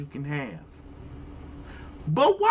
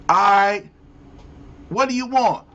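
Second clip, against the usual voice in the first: usual speaking voice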